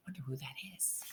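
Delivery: soft unvoiced wondering; whispered aside. A narrator's reading. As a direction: whisper it as a secret. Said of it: "aside to listeners".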